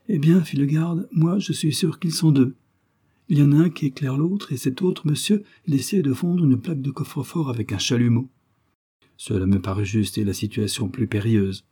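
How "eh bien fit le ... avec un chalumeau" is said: lightly voiced guard, higher pitch; guard reporting. A narrator's reading. Guard tells monsieur about the burglars, earnest report. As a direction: report what you saw, pitched a bit up.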